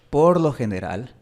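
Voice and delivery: ordinary delivery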